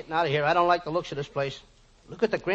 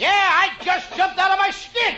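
very high, loud terrified squeal; hammed-up shriek of fright; comic panic; 5 dB louder than usual. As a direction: wail it out, drawn out, scared witless. Frightened comic after skeleton scare.

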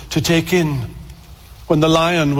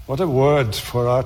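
ordinary delivery

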